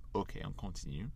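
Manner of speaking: usual speaking voice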